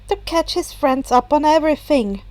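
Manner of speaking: plain reading voice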